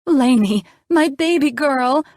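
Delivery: high, loud, rushing mother's cry; a mother calling to her hurt child; worried mom. A narrator's reading. Mom rushing to her daughter's bedside, high and loud.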